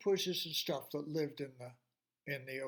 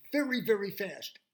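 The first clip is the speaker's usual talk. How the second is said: fast excited telling; 7 semitones higher, 6 dB louder than usual. A narrator's reading. Narrating fast fish.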